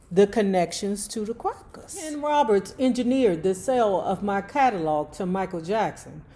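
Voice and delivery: ordinary delivery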